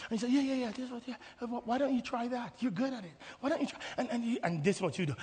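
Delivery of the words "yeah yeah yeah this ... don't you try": hushed, coaxing murmur; very soft, gently persistent prompting; imitated whisper. God coaxes the reluctant believer, in a soft mock whisper. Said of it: "he whispers directly".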